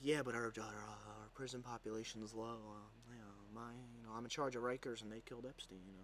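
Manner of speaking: a muffled, evasive mock mumble; imitating the governor. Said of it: "and then he's like".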